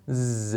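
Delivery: "ز" buzzed in the throat; z sound. Shown as a demonstration. Teacher showing throat vibration, "ز" as a held buzz. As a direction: hold "ز" as a voiced "zzz". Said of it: "باعث لرزش گلوتون شد".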